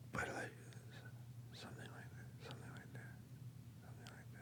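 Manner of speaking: hushed unvoiced whisper, almost gone; a faint, eerie whisper like a distant presence; breathy whisper. Shown as a demonstration. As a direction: breathe the words out, barely there. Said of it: "not quite like this voice".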